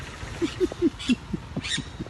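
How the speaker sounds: very high, airless laughter; real giggling fit; high giggle. Real, not acted. Laughing at the story.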